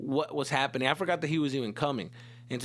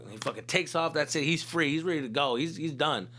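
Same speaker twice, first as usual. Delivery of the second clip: usual speaking voice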